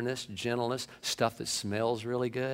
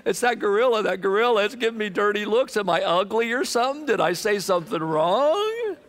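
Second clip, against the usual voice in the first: mock whimpering Adam; 8 semitones higher, 4 dB louder than usual. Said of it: "he's in a corner crying".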